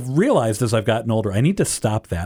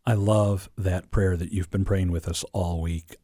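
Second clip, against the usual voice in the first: ordinary delivery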